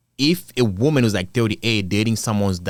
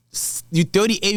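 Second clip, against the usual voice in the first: ordinary delivery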